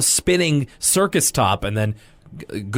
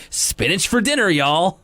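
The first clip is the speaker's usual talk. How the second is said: Southern twang; 7 semitones higher than usual. Retold or imitated. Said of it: "Maybe they're from the South".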